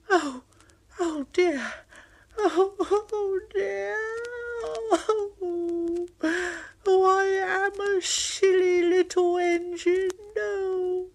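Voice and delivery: high, tearful wailing; whimpering little engine. Acted. Thomas stuck in the hole, crying to himself. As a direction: sob out the self-pity.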